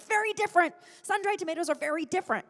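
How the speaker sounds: high, loud, offended insistence; indignant retort. Retold or imitated. Retelling her reply to her cheap ex, high indignant insistence.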